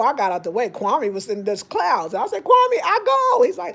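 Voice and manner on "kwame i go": high, loud call across the street. Retold or imitated. Shouting to Kwame on the sidewalk, loud and high. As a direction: call to him to get out of the way.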